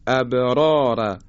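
ابرار said slowly, the ب bouncing with qalqala echo; slow recitation demo. Shown as a demonstration. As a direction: stretch ابرار, let the ب echo. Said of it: "دقت بفرمایید".

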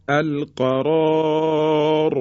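slow "القرار", both Rs thick, long final alef; two heavy Rs. Shown as a demonstration. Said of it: "ما دو تا تفخیم داریم". («القرار» کشیده، هر دو را درشت)

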